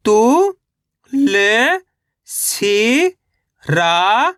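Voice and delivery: each syllable held long, separated by pauses. Shown as a demonstration. Teacher sounding out a word for reading learners, syllable by syllable. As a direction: sound out each letter slowly, stretching it. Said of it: "एक एक अक्षर का उच्चारण".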